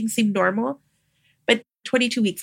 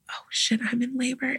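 whispered, softer realization; a hushed oh shit as the truth lands; stunned whisper; 8 dB quieter than usual. Retold or imitated. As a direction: breathe it out as it dawns on you. Hearing the mother say she's in labor.

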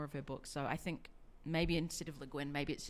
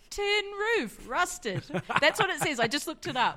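excited; 10 semitones higher, 8 dB louder than usual. Real, not acted. Reading lyrics excitedly.

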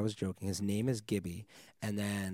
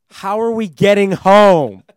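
much higher and far louder, panicked; exasperated shout; 11 semitones higher, 23 dB louder than usual. Retold or imitated. DJ stranded without a ride, exasperated shout. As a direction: yell it in stranded disbelief. Stranded after car stolen.

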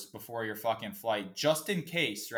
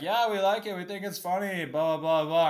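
mock media guy; 7 semitones higher, 4 dB louder than usual. Media guy's reaction, imitated.

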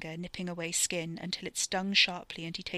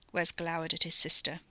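ordinary delivery

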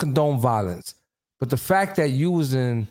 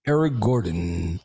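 flat announcer; 8 semitones lower than usual. Retold or imitated. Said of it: "they be like".

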